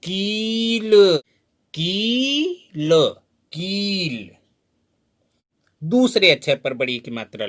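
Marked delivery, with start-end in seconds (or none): 0.0-4.3 कील very slowly with the ई drawn out; teacher models a slow drawn-out कील; long ee. Shown as a demonstration. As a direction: stretch कील, syllable by syllable. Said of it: "अब इसे पढ़ेंगे कैसे?".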